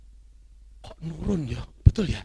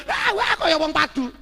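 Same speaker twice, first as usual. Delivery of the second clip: raised, high voice like people squabbling; loud quarrelling talk; 7 semitones higher, 5 dB louder than usual. Shown as a demonstration. Speaker showing how the family talks at home, loud as a quarrel. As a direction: talk as if picking a fight. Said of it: "ngomongnya memang harus".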